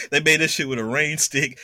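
ordinary talk, nothing marked

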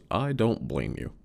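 ordinary talk

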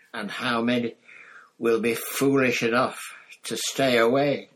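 rising to a loud taunt. Acted. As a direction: throw it out to the graveyard. Villain taunting, building.

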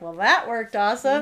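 gleeful laugh as you say it. Real, not acted. Mom delighted her trick worked, laughing. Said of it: "I'm laughing 'cause I'm like".

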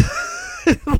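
squealing laugh; high laugh. Real, not acted.

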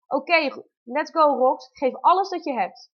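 loud, high push-now coaching; rally her through the contraction; loud urging. Retold or imitated. (vroedvrouw spoort Roxanne luid aan om te persen)

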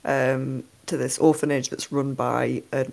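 ordinary delivery

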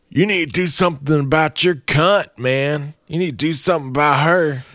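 put-on high, indignant drunk voice; drunk creep. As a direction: lecture the boyfriend like a drunk. Drunk man demands boyfriend act.

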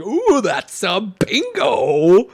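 slow, high, drawn-out ooh; drawn-out villain line. Retold or imitated. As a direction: stretch the ooh like a gleeful villain. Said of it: "that's why it's like".

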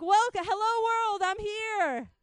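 high, loud, bursting with joy; joyful greeting. Pretend. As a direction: throw your arms open and greet the world joyfully. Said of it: "you're like".

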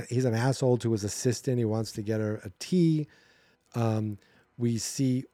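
ordinary talk

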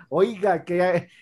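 normal talking voice

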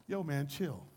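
very low, soft and casual; laid-back, hushed command; unbothered Jesus. Acted. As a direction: murmur it with total calm. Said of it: "And then Jesus gets up".

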